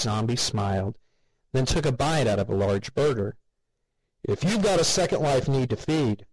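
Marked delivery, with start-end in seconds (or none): 4.2-6.3 booming announcer. A narrator's reading. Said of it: "an off-screen announcer boomed".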